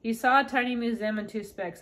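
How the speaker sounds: ordinary delivery, nothing marked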